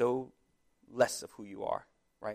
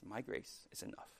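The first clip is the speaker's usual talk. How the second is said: hushed, much softer voice; close to the ear; 13 dB quieter than usual. Acted. God reassuring the listener of his grace, hushed and close. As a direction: say it softly, as if into one listener's ear. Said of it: "he said it right to your ear".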